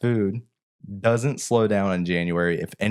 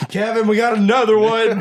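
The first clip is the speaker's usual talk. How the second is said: high, loud mimicked yell; calling out; 14 semitones higher, 7 dB louder than usual. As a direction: holler to a coworker like a fed-up employee. Imitates employee calling out to coworker.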